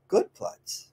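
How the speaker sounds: whispered, breathy praise to the dog; soft praise. Shown as a demonstration. Trainer praising her dog softly. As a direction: say it softly, pleased. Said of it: "you're vocal the way you say it".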